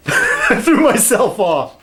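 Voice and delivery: high, drawn-out words through laughter; laughing. Real, not acted. Laughing at himself for losing track, high and laughing.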